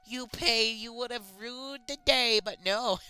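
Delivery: lightly voiced vengeful girl; hammed-up villain. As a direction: ham up the angry killer. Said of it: "I laughed pa-, with anger".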